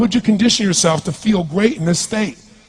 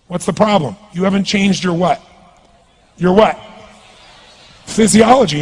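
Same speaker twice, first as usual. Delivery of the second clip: usual speaking voice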